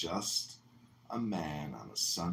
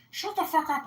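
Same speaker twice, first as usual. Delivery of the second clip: very high, louder squeaky snap; imitating Point; 7 dB louder than usual. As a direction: shriek it like a cartoon brat. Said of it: "He goes".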